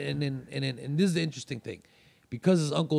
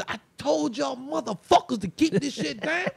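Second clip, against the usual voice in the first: very high, shouted furious tirade; angry scolding; 12 semitones higher than usual. Retold or imitated. Uncle scolding everyone after slamming door, furious. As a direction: tear into them for the noise.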